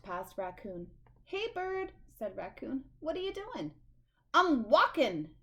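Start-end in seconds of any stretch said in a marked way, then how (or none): 1.2-3.7 high, eager raccoon voice; a nosy raccoon greeting Bird; high, lively raccoon voice; perky raccoon. Acted. 4.3-5.3 loud, slow, irritated snap; grumpy bird snapping loudly. Acted. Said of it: "snapped Bird".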